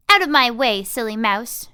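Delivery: loud, cross order to move; grouchy shove-off. Acted. Tweez shooing a pestering mouse, loud and cross. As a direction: bark the mouse out of the way.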